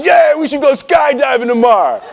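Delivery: put-on drunk bravado. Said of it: "somebody says".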